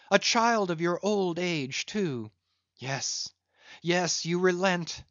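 beg, then call the men excitedly; desperate pleading. Acted. Stranger begging, then urging men.

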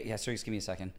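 soft, trailing self-interruption; muttered apology. Retold or imitated. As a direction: mutter it under your breath. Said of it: "You kinda, like, start muttering".

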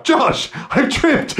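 very high, slow drawn-out call; mock calling out. Pretending to trip over a rock, mock calling out. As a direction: holler for help in hammed-up helplessness. Calling Josh after imagined fall.

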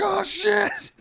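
high, loud shocked yelp; a sudden high loud cry of fright; startled yelp. Retold or imitated. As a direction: jump out of your skin. Dad startled by skull.

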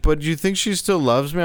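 mock crying wife-beater begging for reassurance; crying cellmate. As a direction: whimper it like a pathetic guy. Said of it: "he's like".

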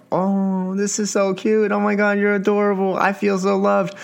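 hammed-up lovestruck delight; mock gushing. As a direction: act out being flattered. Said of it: "you're like".

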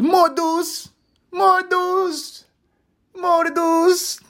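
put-on very high, loud little-kid screech; screaming toddler daughter. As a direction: demand it like a shrieking toddler. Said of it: "banging the door and screaming".

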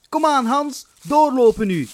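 high, loud impatient call; nasty stepmother yelling. Acted. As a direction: hurry the boy along harshly. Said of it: "riep hun akelige stiefmoeder".

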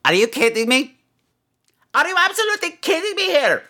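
high, exasperated rant at the callers; a gargoyle host blowing up at his callers; mock outrage. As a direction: play it wildly offended, pitched well up.

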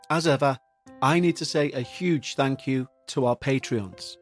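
plain reading voice

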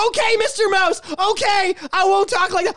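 high whiny surrender; mock meek surrender. [name] giving in to Mickey Mouse, whiny. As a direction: give in meekly, hammed-up.

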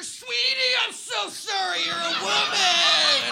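high, loud hammed-up gushing; imitating a man loudly over-apologising; over-the-top apology. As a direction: shout the apology, stretching the end. Men loudly correcting themselves.

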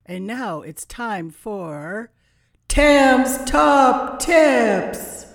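big, slow, loud segment announcement; announcer voice. Acted. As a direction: stretch out the show title like a host.

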